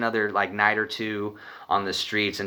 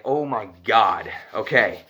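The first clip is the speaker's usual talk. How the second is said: nervous laughing; much slower than usual. Retold or imitated. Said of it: "So I start laughing".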